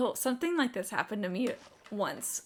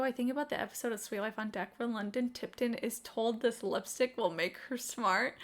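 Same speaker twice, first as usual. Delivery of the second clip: ordinary talk, nothing marked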